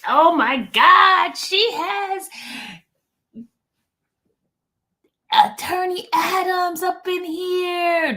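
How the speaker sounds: high, slow, sung-out gush; hammed-up thrilled singing; mock excited sing-song. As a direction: croon it like big news. Gushes over guest arriving.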